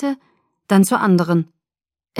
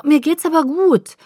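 higher, louder protest; insistent protest; 8 semitones higher, 5 dB louder than usual. A narrator's reading. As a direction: insist you're fine.

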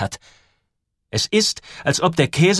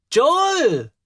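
high, astonished cry of the name; sudden high exclamation of surprise; astonished call; 4 dB louder than usual. A narrator's reading. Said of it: "sagt sie erstaunt".

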